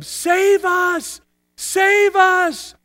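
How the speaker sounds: high, drawn-out cry; crowd crying out. Acted. Preacher contrasts the fickle crowd's first plea, crying out. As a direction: call out long for rescue. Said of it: "those people who cried out".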